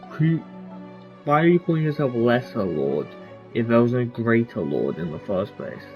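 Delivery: ordinary talk, nothing marked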